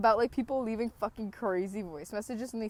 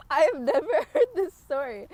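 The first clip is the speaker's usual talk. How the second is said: laughing; 5 semitones higher, 9 dB louder than usual. Real, not acted. Laughing at friend's story.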